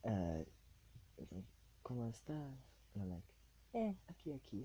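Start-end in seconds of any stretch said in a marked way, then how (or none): 1.9-2.6 put-on Spanish accent. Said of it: "quick little conversation to test out accents". 3.8-4.6 put-on Spanish accent. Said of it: "quick little conversation to test out accents".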